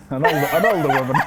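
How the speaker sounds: repeating the words through a laugh. Real, not acted. Host laughing as he echoes her older woman.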